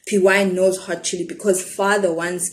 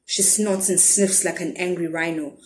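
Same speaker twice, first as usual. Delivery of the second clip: ordinary delivery, nothing marked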